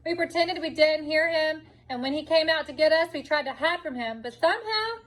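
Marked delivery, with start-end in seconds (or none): 4.3-5.0 dramatic surprise. A narrator's reading. Story climax, voice jumps.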